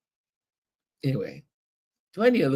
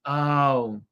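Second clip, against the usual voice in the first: ordinary delivery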